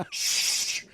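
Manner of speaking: long unvoiced "shh"; shushing. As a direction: hush a noisy latecomer. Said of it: "everybody starts shushing me".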